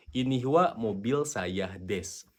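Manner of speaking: slow, clear dictation; slow model sentence. Shown as a demonstration. Teacher modelling the Japanese order slowly. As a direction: lay out each word slowly. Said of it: "gitu kan bahasa Jepangnya".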